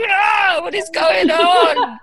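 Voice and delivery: high, loud mock-panicked cry; hammed-up shriek of disbelief; horrified yell. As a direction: yell it in comic alarm, half laughing. Acts out own horrified reaction.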